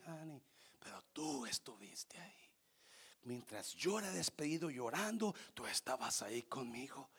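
put-on soft, half-whispered old man reminiscing; weak words from the deathbed; faint dying voice. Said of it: "con apenas voz audible".

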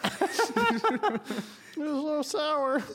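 very slow, high laughing drawl. Real, not acted. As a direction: stretch it out through laughter.

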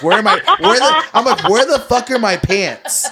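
half-laughing mock panic over the missing pants. Retelling his panic at the moped ride.

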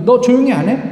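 the crowd threatening the blind beggar, loud and angry; threatening shout. Retold or imitated. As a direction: bark a warning at him. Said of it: "라고 하는 위협".

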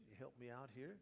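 normal talking voice

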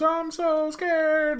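high, slow hammed-up panic; mock the actress's overacted scared line; mock Jessie Spano. Friend quotes a bad actress, hammed-up high panic.

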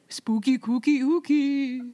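hammed-up singsong, having fun; imitated Santa Claus. Imitating Santa listening to the podcast, playful chant. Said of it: "He's like".

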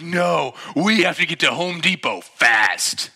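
loud, slow, high emphatic demand; hammed-up desperate impatience; mock urgency. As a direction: hammer out each word insistently. Acted absurd urgent retort.

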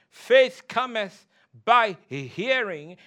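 "faith cometh by hearing" slow, word by word; pointed emphasis. Shown as a demonstration. Preacher asking congregation to notice, measured stress on "hearing". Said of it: "I want you to notice".

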